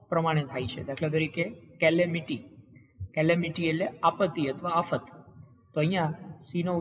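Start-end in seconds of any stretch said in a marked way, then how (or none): none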